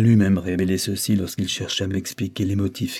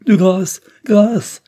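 high, loud pleading that fades to a whisper; imploring; 10 semitones higher, 8 dB louder, much slower than usual. A narrator's reading. Fallen wife begging the count for mercy, pleading. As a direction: beg for mercy, then lose the voice. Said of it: "implora la malheureuse femme".

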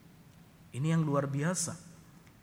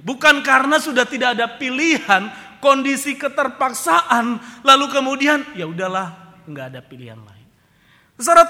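loud, high, ringing sermon cry; full-force preaching; 12 semitones higher, 16 dB louder than usual. Acted. Preacher crying out to the congregation, loud and high. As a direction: preach it out to the whole hall.

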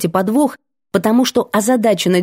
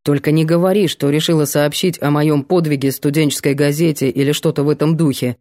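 lower, sullen guy's guess; gloomy young man; 5 semitones lower than usual. A narrator's reading. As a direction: mutter it like a wary hero. Vanya's voice, lower.

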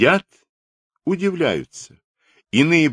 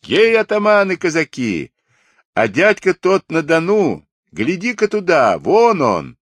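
put-on high, loud excited boy's call; boy shouting; 11 semitones higher, 5 dB louder than usual. A narrator's reading. Boy on a fence hailing the Cossacks, shouting. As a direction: call out high to a whole crowd. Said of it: "крикнул".

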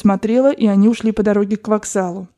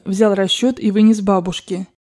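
ordinary delivery, nothing marked

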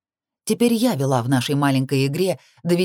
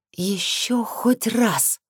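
slow, drawn-out growl, raised pitch; growled warning; 5 semitones higher, much slower than usual. A narrator's reading. Heroine warns the man after the fight, growling slowly. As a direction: growl it word by word as a threat. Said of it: "прорычала я".

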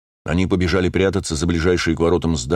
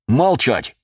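высокий властный окрик; рявкни, обрывая спор; резкий окрик; 6 semitones higher than usual. A narrator's reading. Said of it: "рявкнул я". (рявкает)

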